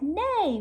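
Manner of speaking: high neigh; goose neighing. Acted. Goose pretending to be a horse, a high neigh.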